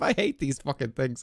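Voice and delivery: ordinary delivery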